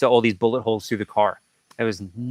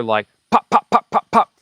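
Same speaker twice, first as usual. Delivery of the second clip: quick mouth pops, rising higher and louder; imitated gunshot pops; 7 semitones higher, 5 dB louder than usual. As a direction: mimic distant gunfire popping.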